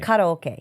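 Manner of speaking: karaoke as kah-rah-oh-keh; Japanese pronunciation. Shown as a demonstration. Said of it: "properly pronounced in the Japanese".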